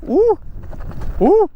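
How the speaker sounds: high excited whoops over the bumps; whooping. Real, not acted. Rider whooping as the bike hits bumps, excited. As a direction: let out joyful high whoops.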